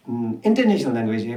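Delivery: usual speaking voice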